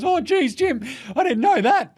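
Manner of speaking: very high put-on squeaky voice; imitating a chastened colleague; mock sheepish protest. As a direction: plead innocence in a silly high voice. Mimics stats man's apology.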